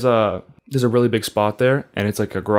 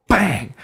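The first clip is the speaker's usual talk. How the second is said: high exclaimed "bang"; impact sound effect; 8 semitones higher than usual. Retold or imitated. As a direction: punch out a sudden crash, pitched way up. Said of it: "different sound effect. Not bang".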